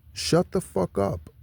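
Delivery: scornful shutdown of armchair complainers; blunt dismissal. Real, not acted. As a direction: slowly tell them to shut it. Scolding complainers about the presidency.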